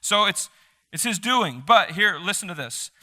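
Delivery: ordinary delivery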